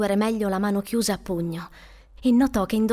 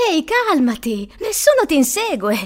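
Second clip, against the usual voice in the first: raised, higher-pitched reassurance; reassuring call; 6 semitones higher, 5 dB louder than usual. Acted. Woman calling after fleeing boy, reassuring. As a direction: call after someone to calm them. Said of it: "lo rassicurò la donna".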